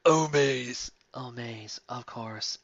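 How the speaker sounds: soft, breathed romantic reply; breathy swoon. Acted. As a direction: swoon as you accept. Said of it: "I breathed".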